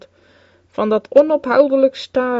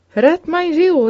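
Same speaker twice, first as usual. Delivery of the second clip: David's plea; 6 semitones higher, slower than usual. Acted. David cries out.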